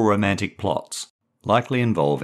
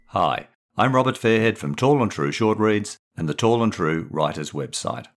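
ordinary delivery

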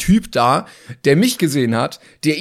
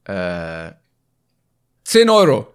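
delivery man; 8 semitones lower, much slower than usual. Retold or imitated. Delivery man's low offer.

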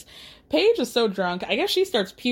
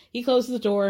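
ordinary talk

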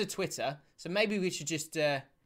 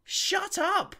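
exasperated rant; 10 semitones higher than usual. Real, not acted. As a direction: scold the rival fans in disbelief. Ranting at Arsenal fans.

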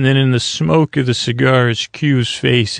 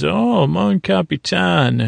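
flamboyant greeting; 6 semitones higher, slower than usual. Retold or imitated. Q greets captain with flourish.

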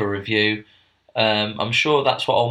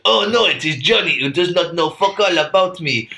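Napoleon voice; 5 semitones higher than usual. Pretend. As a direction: play an offended Napoleon, half-hearted.

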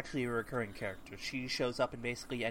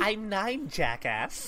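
put-on adult faking child voice; 6 semitones higher, 5 dB louder, much slower than usual.

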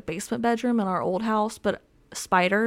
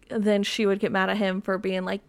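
usual speaking voice

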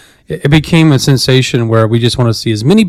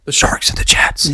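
hushed, whispered movie quote; mock stage whisper; 4 dB quieter than usual. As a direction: whisper it like a playful gang call. Quoting West Side Story playfully.

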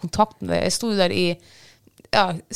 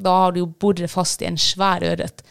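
normal talking voice

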